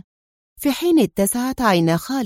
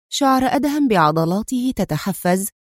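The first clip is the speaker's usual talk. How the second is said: ordinary delivery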